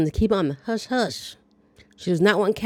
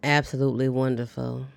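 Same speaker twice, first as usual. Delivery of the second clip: flat, lower deadpan; 5 semitones lower, 3 dB quieter than usual. Retold or imitated. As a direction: say it dry, with no excitement. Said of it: "Jenna's deadpan delivery".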